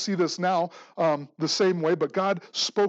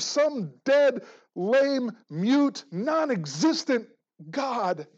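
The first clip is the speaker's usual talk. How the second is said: scornful emphasis; 6 semitones higher, 4 dB louder, slower than usual. Real, not acted. Preacher scorning false gods.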